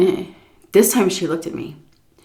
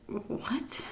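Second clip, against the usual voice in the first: a baffled breathy whisper; whispered what; 6 semitones higher, 17 dB quieter than usual. Retold or imitated. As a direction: whisper it, completely lost. Confused reaction to scolding.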